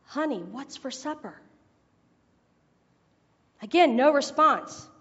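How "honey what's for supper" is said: ordinary talk, nothing marked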